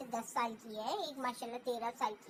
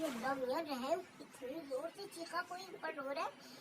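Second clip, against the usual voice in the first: hushed frightened gasp of a retold sister; softly alarmed, mildly imitated; a frightened hush, lightly acted; scared; 5 dB quieter than usual. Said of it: "मेरी बहन डर गई".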